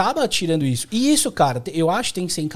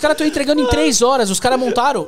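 excited; 8 semitones higher, 3 dB louder than usual. Real, not acted. Heated rant about delivery.

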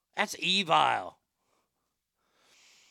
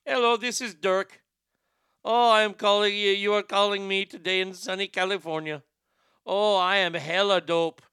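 hammed-up Indian accent, raised voice; comic Indian accent with American slang; 2 semitones higher, 3 dB louder than usual. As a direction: play an Indian agent posing as a Californian. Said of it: "Please do this in an Indian accent".

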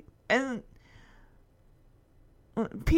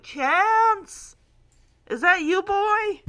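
raised, higher calling voice; 6 semitones higher, 4 dB louder, much slower than usual. Retold or imitated. Jamie calls for his lost dog, acted calling out. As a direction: call hopefully into the distance. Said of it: "kind of putting his hands over his mouth".

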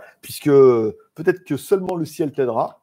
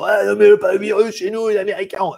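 mocking voice; 6 semitones higher than usual. Mocking quote of Americans.

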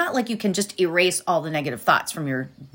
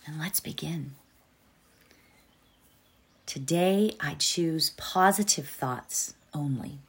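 fading to a hushed whisper; soft whisper; 4 semitones lower, 7 dB quieter than usual. Acted. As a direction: let the mantra drift into a whisper. Meditation fades into whispered mantra.

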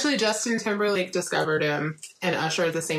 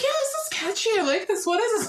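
high, eager take on the fiance; mock fiance. Fiance enjoys the song, mimicked high and eager. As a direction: gush like the fiance did. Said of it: "He's like".

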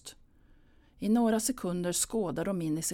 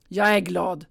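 louder, sulky retort; sour hiss; 8 dB louder than usual. Acted. Pia answering her mother: sour, louder snap. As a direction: spit out the words sourly. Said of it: "fräser Pia surt".

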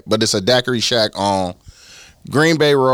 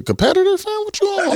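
high, playful mock outrage; mock accusing. Real, not acted. Host teasing a guest for promoting a rival, mock outraged. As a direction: pretend to call him out.

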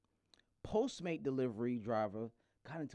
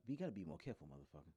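soft, dropping to a breathless whisper; muttered warning; 6 semitones lower, 13 dB quieter, faster than usual. Retold or imitated. Retelling what he'd tell the driver, soft whispered scolding. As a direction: scold under your breath. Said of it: "I'm like".

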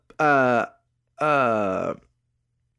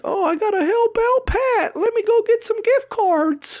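much higher put-on old-lady voice, slow; imitating an elderly mom; 3 dB louder, slower than usual. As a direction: play a gullible old mother, pitched way up and slowed. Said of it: "my mom or somebody's like".

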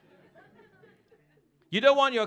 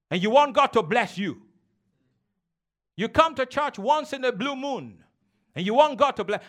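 normal talking voice

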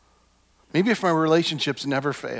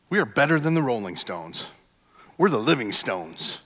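usual speaking voice

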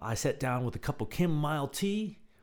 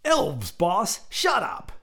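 higher, louder thug snapping; gruff henchman; 7 semitones higher, 5 dB louder, much slower than usual. Acted. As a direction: cut him off like a goon. Henchman voice in the story.

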